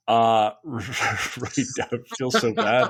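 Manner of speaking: giggling while confessing it feels bad; laughing through it. Real, not acted. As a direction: laugh it out, embarrassed and amused. Laughing while admitting embarrassment.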